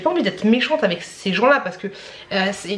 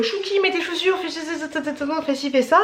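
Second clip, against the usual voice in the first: put-on higher-pitched, rattled-off orders to a child; mum giving orders; 6 semitones higher, 3 dB quieter than usual. Mum telling Chouki to get ready while on the phone, acted harried orders. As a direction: imitate a busy mum hurrying her kid along. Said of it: "elle entendait parce que je disais".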